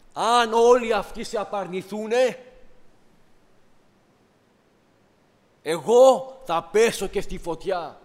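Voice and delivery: δυνατά και αργά, με καύχημα; σαν ο Πέτρος που ορκίζεται φωναχτά πίστη; καυχησιάρικη δήλωση. Retold or imitated. Πέτρος καυχιέται στον Κύριο. (ο Πέτρος καυχιέται δυνατά στον Κύριο, αργά και επίμονα)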